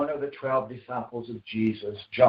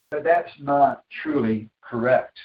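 usual speaking voice

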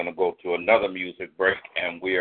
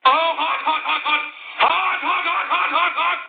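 excited squeal. Real, not acted. Host hypes up poet.

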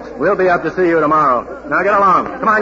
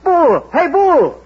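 calling out; 9 semitones higher, 3 dB louder, much slower than usual. Acted. Calling the taxi driver over.

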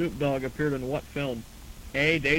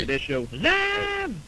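high, slow hyped show announcement; announcer voice; 13 semitones higher, much slower than usual. Pretend. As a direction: belt out the show name like an announcer.

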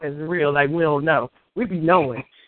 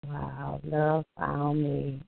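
dreamy hush; 4 semitones lower, 9 dB quieter, much slower than usual. Real, not acted. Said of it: "repeating the poem line dreamily".

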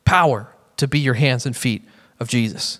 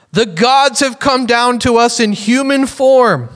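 high, slow proclamation; raised, drawn-out announcing call; crowd shouting; 7 semitones higher, slower than usual. A narrator's reading. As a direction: read it as an awestruck crowd's cry. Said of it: "they shouted in the Lycaonian language".

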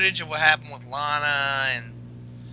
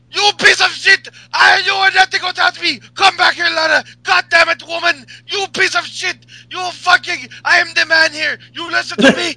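furious yelling, much higher and louder; Rusev raging; 12 semitones higher, 10 dB louder than usual. Retold or imitated. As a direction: bellow at the woman who left you. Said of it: "He's like".

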